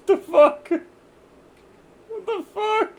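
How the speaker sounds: very high, loud laughing what the fuck; shrieking disbelief. Real, not acted. Streamer reacts to a bizarre TTS joke, squealing high. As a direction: squeal it in shock.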